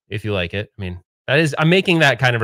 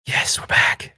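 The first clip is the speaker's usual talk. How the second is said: whisper; much slower than usual. Real, not acted. Answers himself after return.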